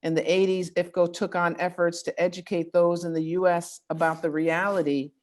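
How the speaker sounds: ordinary talk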